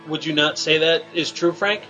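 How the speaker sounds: ordinary talk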